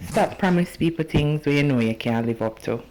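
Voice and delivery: normal talking voice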